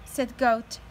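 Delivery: teacher reading a picture book aloud to her class; reading to children. A narrator's reading. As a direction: read it out with energy for little kids.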